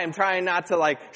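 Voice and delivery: ordinary talk, nothing marked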